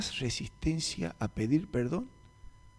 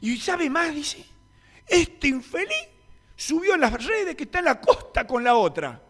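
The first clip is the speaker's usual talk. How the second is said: much higher, louder resentful woman's voice; bitter abandoned wife; 7 dB louder than usual. Retold or imitated. The separated sister rants to the pastor about her husband, bitter and high.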